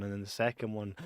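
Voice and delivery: ordinary talk, nothing marked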